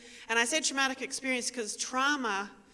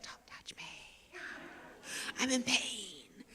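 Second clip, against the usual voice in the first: hushed plea; 9 dB quieter, slower than usual. Retold or imitated. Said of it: "when you're like".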